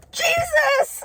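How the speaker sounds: mock astonished gasp; gasping surprise. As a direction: blurt it out like a revelation. Gasping guess in story.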